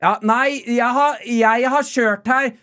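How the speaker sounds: high, stretched-out indignant protest; imitated yelling back. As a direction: imitate a rival driver arguing back. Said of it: "andre dama i den andre bilen skrike tilbake".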